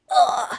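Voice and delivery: short hammed-up pained grunt; wounded orc grunt. Orc hit with an axe in the stomach, mock pained grunt. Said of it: "and he's like".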